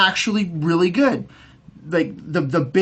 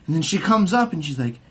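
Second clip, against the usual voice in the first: ordinary delivery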